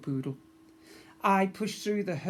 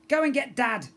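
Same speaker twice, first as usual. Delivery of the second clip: ordering crew; 9 semitones higher, 8 dB louder than usual. Acted.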